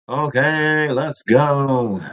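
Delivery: high drawn-out hype shout; hype call. Real, not acted. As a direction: kick it off like a hype man. Stream hype catchphrase.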